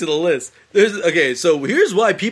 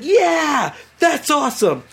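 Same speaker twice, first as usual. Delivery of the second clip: high-pitched sheep-like cheering; high mock cheer; 9 semitones higher, much slower than usual. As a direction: squeak out an eager yeah. Voicing everyone agreeing.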